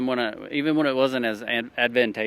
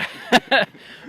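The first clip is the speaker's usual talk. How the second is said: sudden high laugh; burst of laughter; 13 semitones higher, 9 dB louder than usual. Real, not acted. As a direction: laugh out loud, bright and high. Laughs before plugging the Bible.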